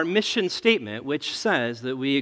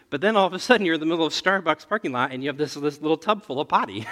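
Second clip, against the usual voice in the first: ordinary delivery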